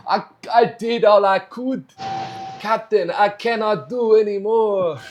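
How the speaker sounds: a clumsy Scottish engineer, pleading; horrible Scottish accent. Pretend. Riffer playing Scotty to his captain, bad Scottish accent. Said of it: "That was a horrible Scottish accent".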